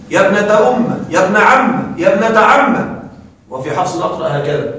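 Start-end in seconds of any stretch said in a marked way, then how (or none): 0.1-3.0 أم and عم end umm-a and amm-a with a short a; fatha on the mim. Shown as a demonstration. Teacher listing the second form, fatha on mim. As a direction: drop the ya and open the mim with a. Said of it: "حذف الياء وفتح الميم".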